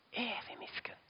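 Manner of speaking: unvoiced, reverent whisper; a hushed reverent whisper; whispered awe. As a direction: whisper it like a secret. Whispered revelation.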